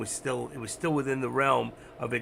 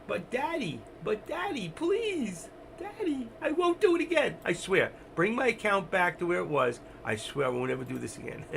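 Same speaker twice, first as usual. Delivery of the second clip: put-on much higher, pleading little-boy voice; whiny begging child; 6 semitones higher than usual. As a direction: beg Daddy like a kid caught out, hammed-up. Son begging God as Daddy.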